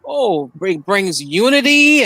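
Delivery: a hint of a mocking quote, drawn out; slow mock-earnest echo of her; imitating the lady. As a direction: lightly mimic her, stretching "unity". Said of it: "the lady that's saying".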